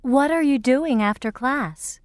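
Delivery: normal talking voice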